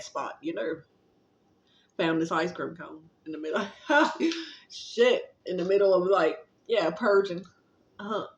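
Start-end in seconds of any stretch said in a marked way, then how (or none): 3.7-4.5 short high burst of laughter; a bright giggle. Real, not acted. Laughs at ice cream find.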